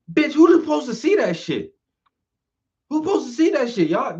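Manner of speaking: high, loud indignant disbelief; exasperated rant pitched high and loud; outraged disbelief. Real, not acted. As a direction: demand to know who could see that. Outraged rant at hidden sign.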